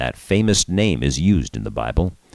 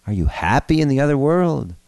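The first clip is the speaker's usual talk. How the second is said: high, eager grandson's voice; crying out; 11 semitones higher than usual. A narrator's reading. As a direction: call eagerly to a long-lost grandfather. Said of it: "cried Milty".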